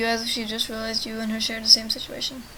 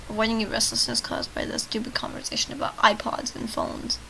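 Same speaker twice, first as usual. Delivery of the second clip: ordinary talk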